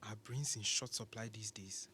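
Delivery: very soft, whispered aside; muttered jab. Acted. Professor sneering under his breath at the interviewer, muttered. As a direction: drop to a near-whisper, as a private dig.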